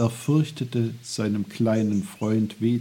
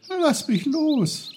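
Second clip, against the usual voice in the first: very high, panting cry to be freed; gasping; 4 dB louder than usual. A narrator's reading. Boy held back by a branch, gasping protest. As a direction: gasp it out, struggling loose. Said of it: "keuchte er".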